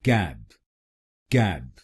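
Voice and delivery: कैब said keb, open e; first syllable. Shown as a demonstration. Teacher drilling the first syllable for students. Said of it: "बोलिए कैब".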